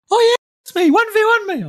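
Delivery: very high, mocking little-kid squeal; nine-year-old voice. Cousin bragging about being best in Fortnite, nine-year-old voice. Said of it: "nine-year-old voice".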